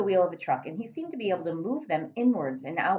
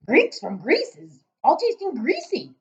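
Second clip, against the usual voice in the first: giant voice; 10 semitones higher, 7 dB louder than usual. Acted.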